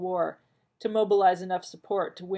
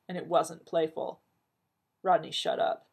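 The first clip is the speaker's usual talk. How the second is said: ordinary delivery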